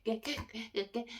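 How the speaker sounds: thick croak on each get; croaky throat sound. Real, not acted. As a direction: croak it through a sore throat. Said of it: "I can just hear myself being like".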